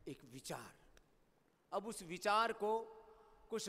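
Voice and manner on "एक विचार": hushed reveal. Acted. Dramatic reveal after pause.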